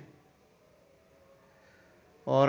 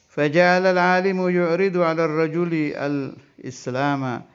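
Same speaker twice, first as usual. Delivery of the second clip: kitab recitation; 5 semitones higher, 6 dB louder than usual. Acted. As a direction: intone the Arabic text like a pesantren teacher. Reads Arabic kitab text aloud.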